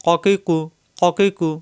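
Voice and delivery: ককি কু run together briskly; quick qaf drill. Shown as a demonstration. Teacher drilling students not to delay, quick joined syllables. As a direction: join the short back-q syllables without dragging. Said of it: "দ্রুত উচ্চারণ".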